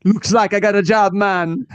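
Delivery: high, loud, hammed-up Jamaican accent; a bad put-on Jamaican accent; fake Jamaican accent. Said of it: "fake a Jamaican accent".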